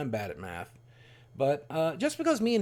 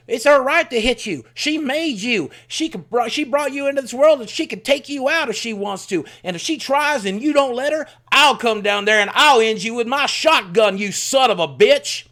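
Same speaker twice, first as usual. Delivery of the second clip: put-on much higher, much louder threatening old man, shouting; furious grandfather; 9 semitones higher, 10 dB louder than usual. Grandfather threatening his grandson with a shotgun, in a furious shout. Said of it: "I'll do his voice".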